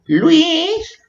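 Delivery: very high, drawn-out playful call; mock calling out. Playfully calling to the boyfriend Luis, high-pitched call. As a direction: call the name in a put-on squeal.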